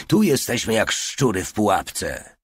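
ordinary delivery, nothing marked

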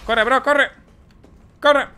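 high, loud urgent yelling; urgent shout. Real, not acted. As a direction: yell for them to run. Urging character to escape. (grito urgente, agudo y fuerte)

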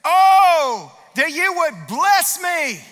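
high, loud, slow plea; crying out to God. Acted. Preacher voicing Jabez crying out to God. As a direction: cry it out to heaven. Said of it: "he cried out to the God of Israel".

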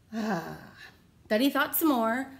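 usual speaking voice

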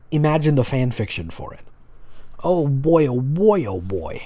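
ordinary talk

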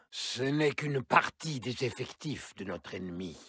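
ordinary delivery

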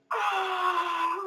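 high, drawn-out mock scream; held-back imitation of an anguished scream; imitated scream. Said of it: "screaming, letting it out, just going".

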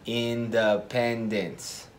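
slow, syllable-counting delivery of Independence and Mississippi. Shown as a demonstration. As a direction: tap out each syllable slowly. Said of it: "contar las sílabas".

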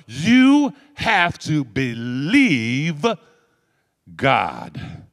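very slow, stretched-out plea; drawn-out emphasis. Real, not acted. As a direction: stretch every word to make it sink in. Preacher pleading to congregation.

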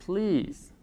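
"Please" with a long ee, softly; "Please" on its own, ea said as ee; ea as Spanish i. Shown as a demonstration. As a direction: model "Please" as "pliis". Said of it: "Pongamos otro ejemplo".

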